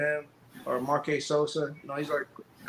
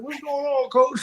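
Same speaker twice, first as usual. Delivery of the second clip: dazed asking coach; 10 semitones higher, 5 dB louder, slower than usual. Retold or imitated. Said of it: "I was like".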